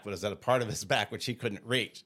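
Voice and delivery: ordinary talk, nothing marked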